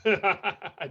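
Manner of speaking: a bright laugh that falls away; giraffe's laugh. Acted. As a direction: laugh at the hare's boast. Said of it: "Giraffe laughed".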